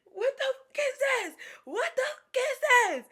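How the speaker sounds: very high, loud hammed-up wail; over-the-top tearful screech; mock crying scream. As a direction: shriek it as if sobbing, exaggerated. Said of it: "I was crying so hard".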